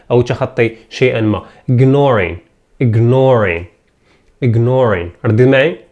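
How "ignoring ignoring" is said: normal talking voice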